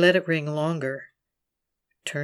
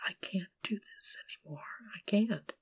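whispered, near-tears breaking down; whimpering; 4 semitones higher, 9 dB quieter than usual. Acted. Hiding with the knife, whimpering that she can't go on. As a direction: fall apart in a whisper. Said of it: "I begin to whimper".

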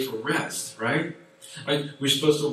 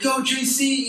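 cheering; 13 semitones higher, 6 dB louder than usual. Retold or imitated. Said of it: "He's like".

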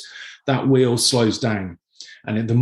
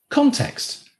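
context jumped up much higher and drawn out; stressed key word; 13 semitones higher than usual. Real, not acted. Dad stressing context to his daughters. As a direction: lift context high to land the point.

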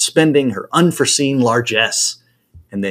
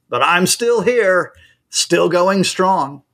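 slow, fond and proud, higher; 6 semitones higher, much slower than usual. A narrator's reading. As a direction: draw it out like an old man who refuses to quit. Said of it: "he fondly observes".

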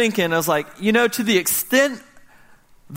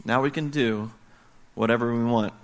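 lower, softer fatherly reply; dad answering his son quietly, a little stumped; quiet resigned answer; 5 semitones lower, 5 dB quieter than usual. Retold or imitated. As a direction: concede it gently after a pause. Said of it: "I stopped and I said".

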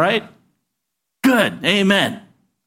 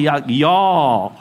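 southern drawl; 6 semitones lower than usual. Shown as a demonstration. Pastor coaching viewers from Chicago, southern drawl. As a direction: lower southern y'all, drawn as jaw. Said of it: "you gotta do that southern drawl".

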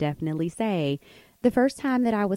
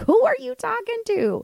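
very high, indignant mock yell; retold shout. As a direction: imitate a friend rounding on someone. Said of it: "And shouted at her".

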